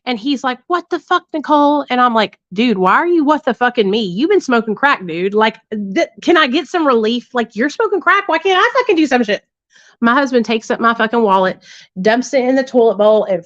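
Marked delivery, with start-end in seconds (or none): 0.7-1.9 a high, mock-outraged husband catching her out; retold angry outburst. Husband finds hidden Xanax, retold fight. 2.5-9.4 higher, indignant comeback, rising at the end; mock the heated argument, climbing in pitch; retold defensive rant. Her retold defiant reply in fight.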